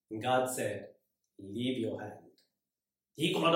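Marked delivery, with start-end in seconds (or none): 1.4-2.3 much softer, lower and calm; quiet divine command. Retold or imitated. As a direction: speak as God, hushed and firm. God voiced in story.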